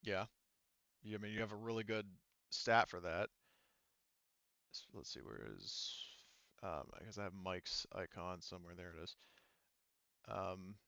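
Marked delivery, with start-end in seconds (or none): 4.7-10.8 much softer, lower mumble while searching; quiet aside, half to yourself; muttering to himself. Real, not acted. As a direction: mutter it under your breath as you look for something. GM talking to himself at screen.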